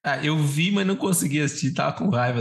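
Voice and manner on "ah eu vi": ordinary talk, nothing marked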